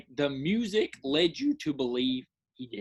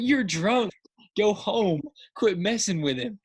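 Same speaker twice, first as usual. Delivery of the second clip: loud, raised-pitch telling-off; mock-indignant yell at the screen; retold scolding; 5 semitones higher, 4 dB louder than usual. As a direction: heckle the bullies like an invested moviegoer. Acting out scolding the movie bullies.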